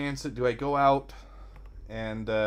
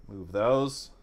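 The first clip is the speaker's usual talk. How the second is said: ordinary talk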